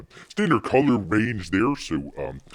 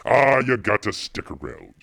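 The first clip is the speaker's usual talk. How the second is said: ordinary talk, nothing marked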